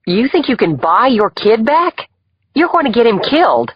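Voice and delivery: losing her cool. A narrator's reading. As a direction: confront the father, temper gone. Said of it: "seemed to have lost hers".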